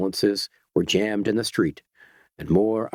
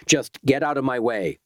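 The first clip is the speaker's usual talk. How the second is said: ordinary delivery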